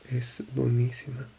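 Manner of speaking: usual speaking voice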